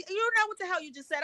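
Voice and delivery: fast, high accusing retort; angry confrontation. Retold or imitated. Retold confrontation with store clerk.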